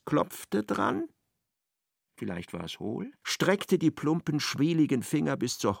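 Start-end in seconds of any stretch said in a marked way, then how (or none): none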